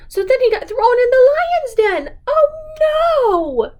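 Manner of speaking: high, loud storybook alarm, almost crying out; hammed-up oh no; mock alarm. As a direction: play up the danger for the child. Dramatic peril for a toddler.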